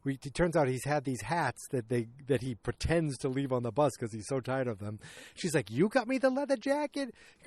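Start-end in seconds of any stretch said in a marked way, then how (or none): 5.7-7.1 high-pitched mock disbelief; astonished question. Woman discovering she got the jacket, astonished. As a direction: climb high on the question, imitating amazement. Acted surprise at a gift.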